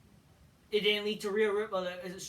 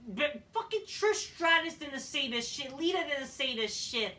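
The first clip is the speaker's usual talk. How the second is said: high-pitched, indignant protest; worked-up, fed-up complaint; exasperated rant; 6 semitones higher, 3 dB louder than usual. Real, not acted. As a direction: protest angrily with raised pitch. Ranting against the promo's claims.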